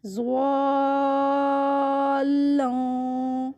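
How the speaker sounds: زوالن stretched long, nun nasalised; long madd with ghunna. Shown as a demonstration. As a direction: hold the aa in زوالن then hum the nun. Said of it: "اس پہ غنہ کرنا ہے". (زوالن لمبا، نون پر غنہ)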